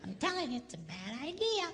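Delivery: high, firm wifely insistence; mock insistent wife. Wife insists in retold exchange.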